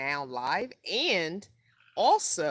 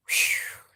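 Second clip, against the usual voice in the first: breathy whew; 9 dB louder than usual. Real, not acted. Recalling hard wisdom teeth week.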